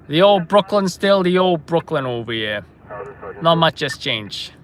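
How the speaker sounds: put-on old-timer reminiscing in Brooklynese; put-on Brooklyn accent. [name] recalling the old Brooklyn, put-on Brooklyn accent. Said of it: "Brooklyn accent".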